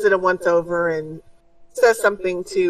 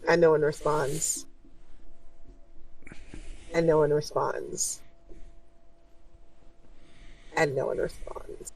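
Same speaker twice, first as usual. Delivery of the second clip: fading away; 3 semitones lower, 10 dB quieter than usual. A narrator's reading. Repeated line fading into silence.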